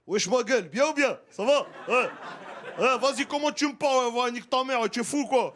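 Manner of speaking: put-on swagger like a Paris street kid; Paris street slang. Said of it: "il y a un mec qui va te dire".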